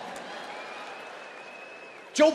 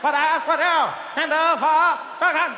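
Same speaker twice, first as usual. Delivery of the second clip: mock JFK speech cadence; hammed-up Kennedy oratory; JFK impression; 6 semitones higher than usual. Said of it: "He had that thing where it's like".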